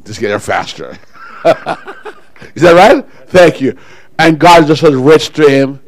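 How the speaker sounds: usual speaking voice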